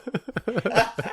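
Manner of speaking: high, drawn-out mock wail; horrified "oh". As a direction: let out a high cringing groan. His horrified reaction to daughter.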